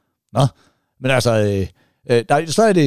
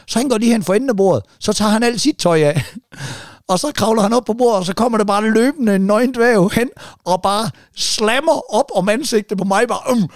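higher-pitched, building telling of the climax; excited storytelling; 6 semitones higher than usual. Real, not acted. The teller to friends about the naked dwarf, excited and high. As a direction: race toward the punchline.

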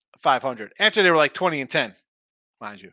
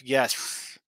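usual speaking voice

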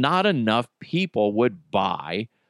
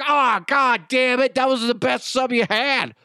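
much higher, whiny mock complaint; complaining customer; 10 semitones higher than usual. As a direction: whine like a customer who lost his sandwich. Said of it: "one or two people complain".

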